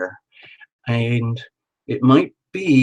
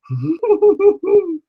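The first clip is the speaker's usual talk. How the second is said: a sudden squeaky laugh, much higher; high burst of laughter; 4 dB louder than usual. Real, not acted. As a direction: laugh out high and short, caught off guard. Delighted at her own phrasing.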